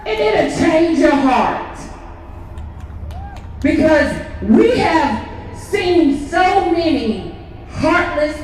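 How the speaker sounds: fervent rally speech, slow and loud; preaching. Real, not acted. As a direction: preach it to the crowd.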